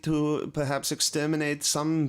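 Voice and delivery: mock German. Mocking a stiff German engineer.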